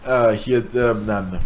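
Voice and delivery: usual speaking voice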